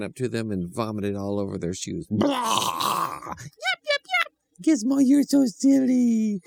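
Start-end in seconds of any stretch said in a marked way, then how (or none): 2.1-3.2 high cartoon blah of vomiting; silly vomit sound effect; puppy retching noise. A narrator's reading. 3.4-4.3 very high tiny yips; cartoon puppy chirping; squeaky puppy voice. A narrator's reading. Puppy after vomiting. 4.6-6.4 much higher and slow, fond; sweet children's voice. A narrator's reading. Children scold puppy fondly.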